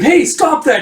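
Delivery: high, loud cry of protest; alarmed yell. Retold or imitated. Shepherd shouts as the sheep get scared, alarmed. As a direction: yell it high, as if to halt someone.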